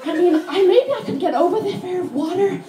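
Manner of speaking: mocking a tourist. Comic imitating a nervous tourist to the audience, high and loud. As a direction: lay on the dumb tourist voice. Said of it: "She's like".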